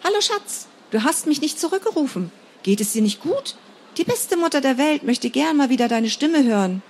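mother leaving a sugary message; mother on answering machine. Acted. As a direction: coo like a doting mother on the tape.

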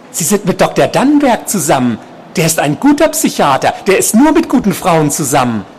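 high, emphatic stalker voice; obsessed patient, insistent. A narrator's reading. Patient telling nurse about her lover, high insistent voice. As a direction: insist on it in a high, loud-edged voice.

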